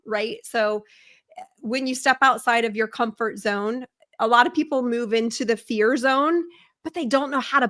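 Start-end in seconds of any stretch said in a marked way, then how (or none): none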